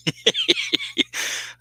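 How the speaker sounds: very high-pitched giggle; real giggle. Real, not acted. Host laughing at his Irish-for-Scottish slip, giggling. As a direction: giggle helplessly at your own blunder.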